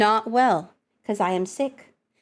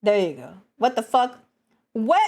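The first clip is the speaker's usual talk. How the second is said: usual speaking voice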